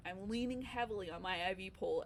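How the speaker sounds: usual speaking voice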